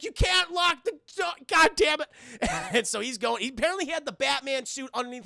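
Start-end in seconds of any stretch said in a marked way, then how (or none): 0.0-2.1 high, exasperated protest getting louder; a guard fed up with locked doors, high and irritated; annoyed guard. Retold or imitated. Security guard yelling at Bruce through the door, high and exasperated. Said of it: "the security guard's like".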